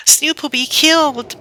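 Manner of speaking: put-on high, panicked little girl's cry; a little girl terrified for her kitten; frightened exclaim. Little girl watching her kitten fly away, high frightened cry. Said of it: "exclaimed the little girl".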